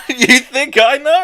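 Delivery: high laughing disbelief. Real, not acted.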